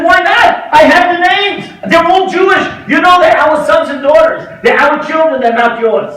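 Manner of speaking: high, loud, insistent protest; pleading shout. Retold or imitated. Rabbi arguing with the Pope over the children, shouting. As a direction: shout back that they are ours. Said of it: "I pleaded with him".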